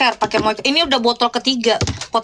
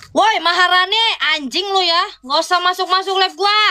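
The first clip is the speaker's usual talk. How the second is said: sharp, higher scolding call-out; telling off a viewer; 7 semitones higher than usual. Real, not acted. Streamer scolding Maharani for joining her live, annoyed call-out. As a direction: snap at the viewer crashing the live.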